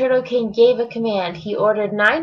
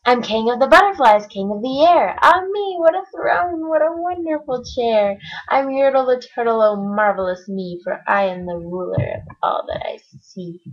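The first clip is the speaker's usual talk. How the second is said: higher, excited boasting; higher gleeful king; gloating king; 3 semitones higher than usual. Acted. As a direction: crow with delight at the view. Yertle gloating on high.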